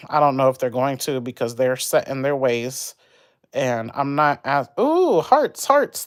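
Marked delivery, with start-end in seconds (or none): 4.8-6.1 high, delighted squeal over the hearts; light up with sudden joy; excited squeal. Real, not acted. Streamer spotting viewers' hearts, high delighted squeal.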